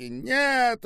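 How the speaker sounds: high, loud, desperate cry; frantic scream. A narrator's reading. Olga watches the old woman being seized, frantic scream. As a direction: scream it out in horror. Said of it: "истошно закричала Ольга".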